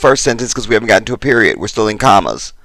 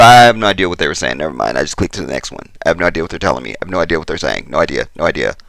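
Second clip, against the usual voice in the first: resigned muttering; 7 semitones lower, much faster than usual. Retold or imitated. Viewer giving up on a garbled comment, resigned muttering. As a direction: give up and mumble it lower and lower.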